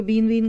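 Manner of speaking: बीन वीन misread with a long "ee" vowel; mistaken reading. Shown as a demonstration. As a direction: say बीन वीन as "been veen", a learner's misreading. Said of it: "पढ़ने में कई गलतियां करेंगे".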